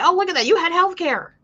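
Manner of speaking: high-pitched sing-song mockery; mocking commenter. Retelling online comments about the mother, mocking high voice. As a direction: imitate a commenter in a put-on high voice.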